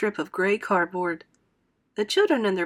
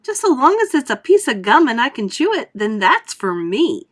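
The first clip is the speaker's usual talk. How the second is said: put-on high, loud girl's shout; 4 semitones higher, 8 dB louder than usual. Violet to everyone, shouting high and loud. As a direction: shout it, only caring about gum.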